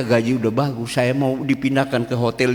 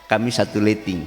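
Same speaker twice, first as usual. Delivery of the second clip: usual speaking voice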